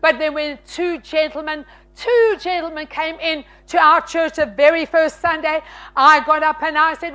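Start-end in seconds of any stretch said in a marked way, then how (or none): none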